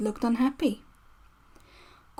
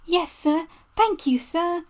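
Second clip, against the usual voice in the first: much higher, eager little engine; happy; 6 semitones higher than usual. Acted. Percy thanking the Fat Controller for his new name, high and happy. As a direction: chirp it out, delighted to be chosen. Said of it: "said Percy happily".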